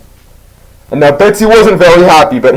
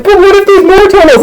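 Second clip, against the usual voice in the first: high falsetto mock worry; worried friend; 3 dB louder than usual. Homie fears there are more toenails, mock panic.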